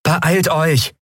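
high-pitched, pressing urge; urging. A narrator's reading. Endriel hurries her companions along, urging. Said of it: "drängte Endriel".

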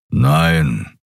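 Keru's grumble. A narrator's reading. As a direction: growl the word from deep down. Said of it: "brummte Keru".

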